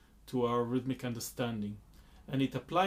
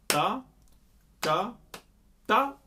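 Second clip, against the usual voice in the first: ta lifted much higher, light upbeat after Ta ka; last ta as upbeat; 7 semitones higher, 5 dB louder than usual. Shown as a demonstration. Teacher showing ta as an upbeat. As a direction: flick the last ta up high. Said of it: "will feel like an upbeat".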